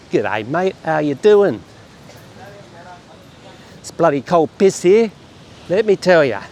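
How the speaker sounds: mock broad Aussie accent; imitated Aussie accent. Vlogger mimics the barman's Aussie greeting, in Aussie accent. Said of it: "he got the accent right".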